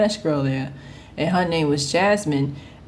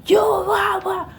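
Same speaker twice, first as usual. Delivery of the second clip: angry shout; 13 semitones higher, slower than usual. Retold or imitated. Young worker yelling at her coworker, shouting. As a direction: yell it like losing your temper. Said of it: "I'd be like".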